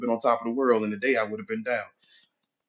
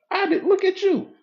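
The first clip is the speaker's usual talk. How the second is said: gushing admirer; 3 dB louder than usual. Acted. Naysayer now gushing over success.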